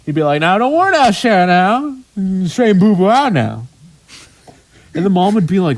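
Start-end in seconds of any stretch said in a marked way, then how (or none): 0.4-3.7 higher, louder imitation of a Cajun dad. Dad reassuring mom in Cajun, higher, louder Cajun imitation. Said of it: "he had a very funny way of talking".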